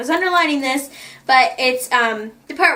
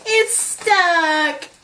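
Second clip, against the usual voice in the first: a squeaky put-on voice, a bit higher; mock stuck complaint; 5 semitones higher than usual. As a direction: play Hazel stuck in her shirt. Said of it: "I'm like".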